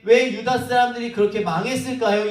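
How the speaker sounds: ordinary talk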